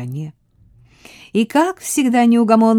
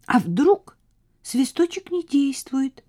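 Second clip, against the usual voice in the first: put-on high, doubtful girl's voice; worried young girl, lightly voiced; little girl voice; 7 semitones higher, slower than usual. A narrator's reading. Ellie character voice.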